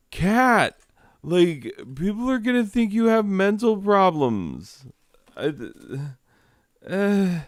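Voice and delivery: squeaky, fed-up complaint, easing slightly by the end; exasperated. Real, not acted. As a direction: plead with the cat at the end of your patience. Pleading with the interfering cat.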